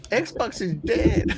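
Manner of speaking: half-strength mock troll, drawn out; imitating the troll voice. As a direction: copy the squeal lightly while laughing. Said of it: "how that voice you made".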